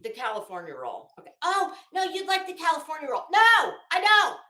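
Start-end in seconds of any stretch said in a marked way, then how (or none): 1.4-3.2 high, quick mock-persuasive voice; mock pushy friend. Mimicking friends who insist she'd like the California roll. Mocking sushi fans' advice. 3.3-4.4 loud, high flat no; emphatic refusal. Real, not acted. Refusing the sushi pushers, loud and high.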